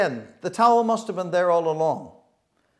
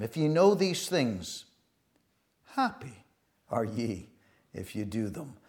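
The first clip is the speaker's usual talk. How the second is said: slow, low and soft, pausing before each phrase; hushed scripture reading; 7 semitones lower, 7 dB quieter than usual. Real, not acted. Preacher reading the verse's surprise ending to brethren, hushed. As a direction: let "happy are ye" sink away quietly.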